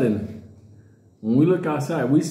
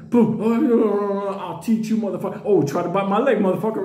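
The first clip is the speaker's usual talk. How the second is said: higher, lively retold fight shouting; mock brawl yell; 6 semitones higher than usual. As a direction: Earl taunting the bear mid-fight. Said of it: "we're hearing".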